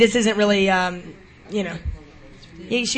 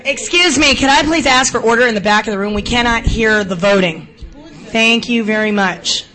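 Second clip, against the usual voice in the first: loud, raised pitch, firmly projected; calling for order; 3 semitones higher, 7 dB louder than usual. Real, not acted. Chair asks the back of the room for order, calling out loudly.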